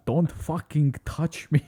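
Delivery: high, slow, foreign-accented attempt at Scottish; botched Scottish impression. Imitating friend's impression of a Scotsman, botched Scottish accent. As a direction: drag it out in a badly mangled Scottish accent. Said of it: "his impression of a, of a Scotsman".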